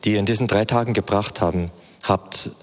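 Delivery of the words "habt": usual speaking voice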